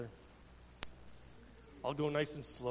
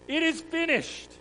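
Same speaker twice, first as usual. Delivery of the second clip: higher triumphant call; loud cry; 14 semitones higher, 8 dB louder than usual. Acted. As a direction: proclaim it with the last breath. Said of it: "shouted out in a loud voice".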